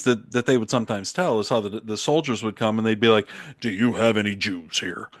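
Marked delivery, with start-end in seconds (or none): 3.6-5.1 half-hearted mock German soldier accent. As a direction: attempt a German accent, slightly stiff and deliberate. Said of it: "but in their German accent".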